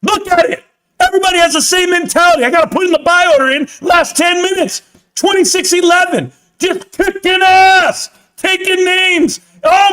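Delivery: pumped up, shout it out as the price climbs; excited yelling. Real, not acted. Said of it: "Hi, I don't mean to yell".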